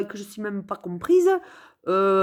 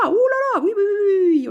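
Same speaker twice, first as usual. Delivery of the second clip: excited exclamation; 9 semitones higher, 4 dB louder than usual. Real, not acted. Delighted exclamation mid-chat.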